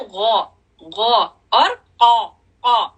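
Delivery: गौ and कौ said slowly and heavily; slow heavy letters. Shown as a demonstration. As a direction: draw out गौ and कौ from the throat. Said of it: "गौन का क्या है".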